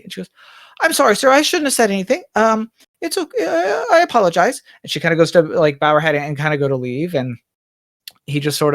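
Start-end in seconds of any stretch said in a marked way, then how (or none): 0.8-4.6 higher, flustered servant voice; a maid embarrassed at overstepping; apologetic maid Bonnie. Acted. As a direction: backpedal quickly and apologise. Maid apologises to her master.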